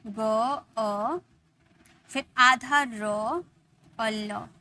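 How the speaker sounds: गर्ल broken into ग, अ, र, ल slowly; sounded out. Shown as a demonstration. Teacher breaks गर्ल into its sounds for the class. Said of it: "तोड़ कर लिखेंगे तो इस तरीके से आएगा साउंड".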